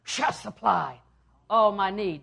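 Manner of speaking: booming declaration of faith; triumphant proclamation. Acted. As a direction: proclaim it with full force. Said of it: "He said".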